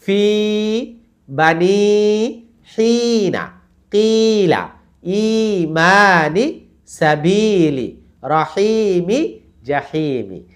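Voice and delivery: slow word list with every long i sustained; recitation drill. Shown as a demonstration. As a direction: chant ফি, বানি, হিনা on held notes. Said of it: "total ইয়ামাতগুলো বলি".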